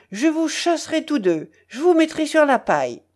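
high, heated threat; angry howl. A narrator's reading. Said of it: "hurla Lambert".

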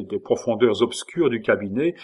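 ordinary delivery

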